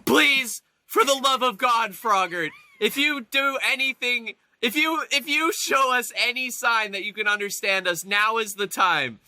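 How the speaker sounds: desperate pleading. Acted. Player begging the frog to show it understands, pleading. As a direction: beg for a sign, leaning on each please. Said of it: "but I'm like".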